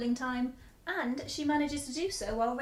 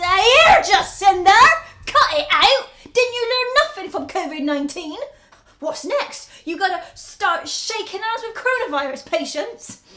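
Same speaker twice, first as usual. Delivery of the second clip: loud, higher furious telling-off; angry shout; 7 semitones higher, 15 dB louder than usual. Acted. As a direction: yell at her to cut it out. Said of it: "I'd say".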